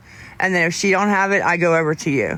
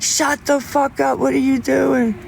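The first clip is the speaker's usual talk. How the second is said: angry dad; 8 semitones higher than usual. Retold or imitated. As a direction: snap at him like a fed-up dad. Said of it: "He gets so mad".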